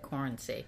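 "Corency" stated low and soft, falling; soft, matter-of-fact "Corency", tune dropping at the end; confirmed pronunciation. Shown as a demonstration. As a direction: confirm "Corency" quietly with a settled fall. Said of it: "That's how I said it".

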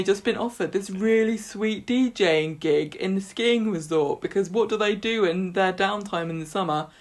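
a lightly voiced moaning Ryan; mock whiny Ryan. Ryan to Daisy, lightly voiced moaning invite. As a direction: moan on, then dangle the offer. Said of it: "he's like".

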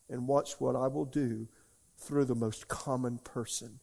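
measured prophetic utterance; prophetic word. Real, not acted. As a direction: declare it slowly, as the voice of God.

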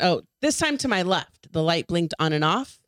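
ordinary delivery

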